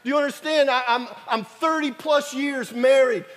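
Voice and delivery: loud, driving sermon delivery; preaching. Acted. Preacher urging the congregation to beg, loud fervent preaching. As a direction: hammer the point to the congregation.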